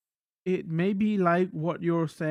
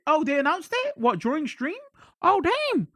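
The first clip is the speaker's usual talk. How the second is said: higher-pitched surprised questions; 9 semitones higher than usual. Real, not acted. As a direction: react to unexpected news, voice jumping up. Reacting to announcement read from chat.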